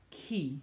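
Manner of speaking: chi said "kee" with a K; hard C. Shown as a demonstration. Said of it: "that C becomes hard".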